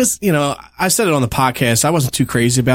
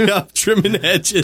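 lightly mimicked hedge trimmer, higher and drawn out; playful, slow mimicry through a laugh; a hint of a goofy gamer at work; Rory; 6 semitones higher than usual. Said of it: "that's Rory trimming the hedges".

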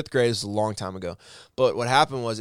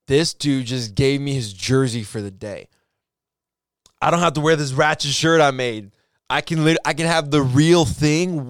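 overjoyed disbelief; 6 dB louder than usual. Retold or imitated. Fifth-grader thrilled his teacher's son gave him his jersey, overjoyed. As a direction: savor each word like you won the lottery. Retelling joy at gift jersey.

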